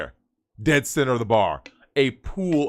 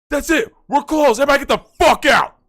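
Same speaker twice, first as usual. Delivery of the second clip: high, loud mock bouncer shout; imitating a bouncer ordering everyone out, high and loud; bouncer yelling; 12 semitones higher, 6 dB louder than usual. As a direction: bark it like a bouncer clearing the bar out. Bouncers suddenly clearing the bar.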